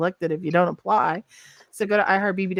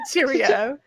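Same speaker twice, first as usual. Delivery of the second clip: higher mock-British lilt; playful put-on British; British accent; 4 semitones higher than usual. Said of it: "let's hear your British accent".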